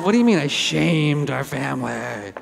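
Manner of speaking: slowed, disbelieving comeback; mildly hurt, echoing his words back; indignant reply. Retold or imitated. Speaker answering his brother, lightly mock-indignant. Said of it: "And I said".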